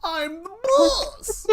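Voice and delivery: slow, high, loud hammed-up tantrum; mock boss outrage. Mock boss scolding an employee for contradicting him. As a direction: play a pompous boss losing it.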